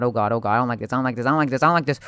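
usual speaking voice

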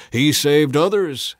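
loud, slow sneer; scoffing. A narrator's reading. Priests scoffing at Jesus on the cross, slow loud sneer. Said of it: "they scoffed".

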